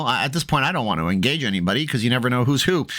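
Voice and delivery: usual speaking voice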